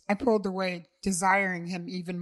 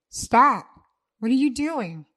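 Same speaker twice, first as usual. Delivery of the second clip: loud, higher cry of alarm; sharp alarmed shout, louder and higher; startled shout; 5 semitones higher, 8 dB louder than usual. Acted. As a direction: yell it out in shock.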